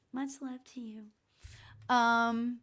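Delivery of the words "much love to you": an airy, hushed send-off of love; soft whispered sign-off. Real, not acted. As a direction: breathe it gently as a farewell. Signing off warmly to a sitter.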